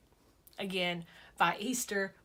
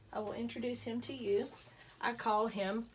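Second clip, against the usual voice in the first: ordinary talk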